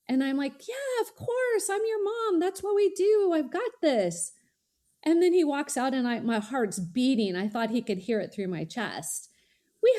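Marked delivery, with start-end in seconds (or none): 0.7-4.3 much higher, bright mock-confident reassurance; reassuring mom. Mom reassures her son she has it handled, higher and bright. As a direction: play it up as the unflappable mom.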